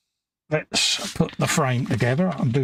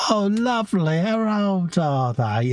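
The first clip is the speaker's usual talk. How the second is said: put-on high, slow cooing baby talk; 6 semitones higher, slower than usual. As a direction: coo it like talking to a baby. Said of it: "In that little baby voice that people seem to do".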